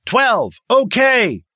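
high, loud sound-off; yelled. A narrator's reading. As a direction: yell it from the back. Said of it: "Collins yelled".

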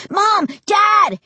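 loud, high-pitched cries for help; shrieking. A narrator's reading. Terrified boy running downstairs to his parents, shrieking. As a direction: yell for your parents in panic.

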